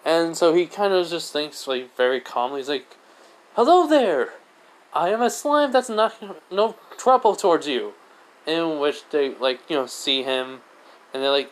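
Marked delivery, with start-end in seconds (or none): 3.6-7.9 high, loud, projected greeting; big high-pitched hello; hailing. Acted. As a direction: announce yourself to strangers, pitched well up. Slime hails the goblins.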